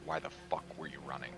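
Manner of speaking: usual speaking voice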